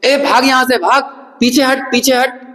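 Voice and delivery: put-on sharp high call to a child on a ledge; urgent warning. Calling a child away from the ledge, urgent and high. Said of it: "तो अपन क्या बोल देंगे?".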